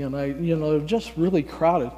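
ordinary talk, nothing marked

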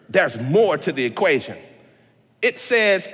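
ordinary talk, nothing marked

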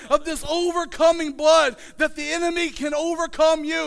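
rhythmic refrain swelling into a high, loud shout; building fiery preaching. Real, not acted. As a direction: climb from measured pleading to full-force revival preaching. Preacher builds refrain to full force.

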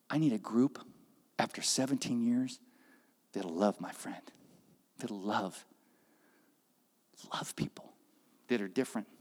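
soft hushed tender plea; tender divine voice. Retold or imitated. God tells the congregation to love others, tender. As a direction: speak gently like a loving God.

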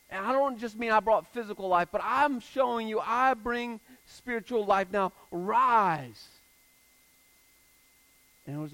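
loud, high, impassioned sermon voice; fervent rising preacher's cry; preaching at full force. Acted. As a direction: proclaim it to the whole room. Preaches the resurrection.

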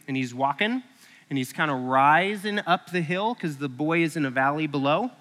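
usual speaking voice

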